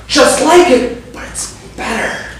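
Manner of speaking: ordinary talk, nothing marked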